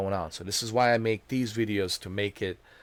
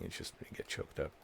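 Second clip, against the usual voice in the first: choked-up whisper; 5 semitones lower, 15 dB quieter than usual. Real, not acted. Moved by the scene.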